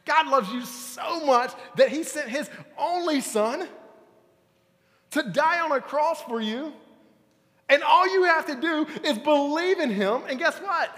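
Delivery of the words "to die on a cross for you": normal talking voice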